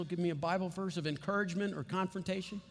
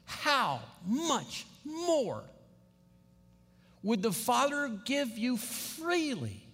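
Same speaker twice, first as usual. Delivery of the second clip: HOW, MUCH, MORE drawn out high, one by one; emphatic key words; 4 semitones higher, much slower than usual. Shown as a demonstration. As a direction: stretch HOW MUCH MORE and FREELY very slowly. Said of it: "I will emphasize the words that are emphatic".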